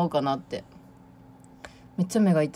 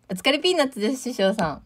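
usual speaking voice

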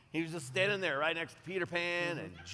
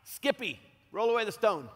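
raised, louder call across the tomb; 6 semitones higher, 5 dB louder than usual. Acted. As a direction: holler an order to a helper. Calls out to Skippy in skit.

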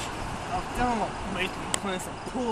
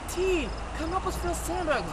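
higher, pleading women's voice; mocking the women; 10 semitones higher than usual. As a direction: mimic the women begging for help. Women voiced in his story.

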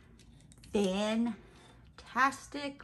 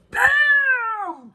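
a big shouted Bam, high and loud; 14 semitones higher, 14 dB louder than usual. Acted. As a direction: burst in like the Kool-Aid Man. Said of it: "Busted in like the Kool-Aid Man".

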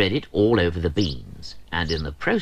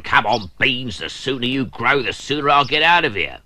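higher, louder impatient urging; fed-up grumbling plea; impatient Cranky; 6 semitones higher, 5 dB louder than usual. Acted. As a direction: hurry the beans along. Cranky urging his beans.

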